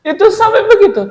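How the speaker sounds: high, fast laughing words. Real, not acted. Laughing with emotion while telling the guest about the patient. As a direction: laugh the words out, nearly crying.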